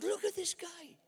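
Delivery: high, wide-eyed thrill at a miracle; high-pitched awestruck thinking aloud; amazed inner voice. Retold or imitated. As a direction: sound like a kid discovering something astonishing. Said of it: "I thought".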